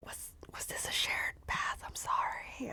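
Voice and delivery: hushed, sheepish stammer; guilty whisper. Acted. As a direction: whisper the apology as if mortified. Guilty hushed apology about the bath.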